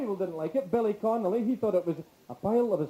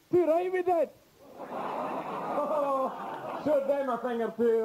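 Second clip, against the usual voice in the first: high-pitched proud call; fired-up audience member; 6 semitones higher than usual. Retold or imitated. Cinema-goer standing in his seat in Glasgow, fired-up high cry. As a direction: pipe up high with patriotic fervour. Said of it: "standing in his seat by now going".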